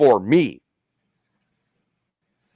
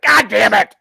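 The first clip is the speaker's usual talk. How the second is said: mock exasperation; 8 semitones higher than usual. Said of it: "it's like".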